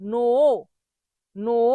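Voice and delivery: নো with a long dragged "oo"; common mispronunciation. Shown as a demonstration. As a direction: stretch each নো into "nooo". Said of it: "ভুল উচ্চারণ যারা করে তারা কি করে?".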